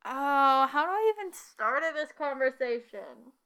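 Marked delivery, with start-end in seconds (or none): none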